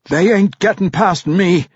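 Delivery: high-pitched battle cry; defiant roar. A narrator's reading. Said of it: "Bruner roared".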